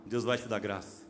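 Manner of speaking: rousing preaching. Real, not acted. Preaching at full force.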